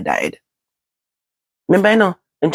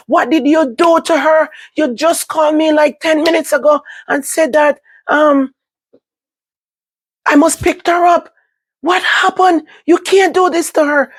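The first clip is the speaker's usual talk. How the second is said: scream bawl; 12 semitones higher than usual. Retold or imitated. Begging the hospital to bring mother back, screaming. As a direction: shout it up high, desperate. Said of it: "I me start scream bawl".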